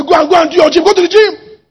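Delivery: coach's order. Acted. Coach sending an athlete to the gym, urgent order. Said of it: "The coach will say".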